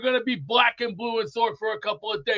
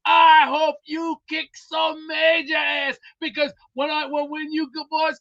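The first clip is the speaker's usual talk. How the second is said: rallying shout; 6 semitones higher than usual. Real, not acted. Rallying the boys out in Iran with a slow shout.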